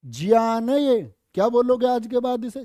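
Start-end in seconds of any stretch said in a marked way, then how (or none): 0.1-1.1 ज्ञान said slowly as ग्यान; correct pronunciation. Shown as a demonstration. Teacher correcting students on ज्ञान, slow. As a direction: stress ज्ञान, slow and clear. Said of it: "तुम्हें ज्ञान ही तो नहीं है".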